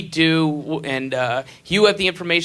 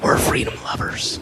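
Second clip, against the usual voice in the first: whispered slogan. Retold or imitated. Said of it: "whispering that".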